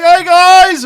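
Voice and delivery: loud, high shout back; hollered greeting. Retold or imitated. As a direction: imitate an old pal hollering hello. Bill hollers back to the kids.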